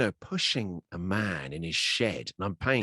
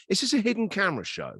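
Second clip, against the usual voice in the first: higher, louder mock-incredulous demand; retold disbelief, pitched up and pushed louder; incredulous challenge; 10 semitones higher, 4 dB louder than usual. Client challenging his shed therapist for credentials, mock incredulous.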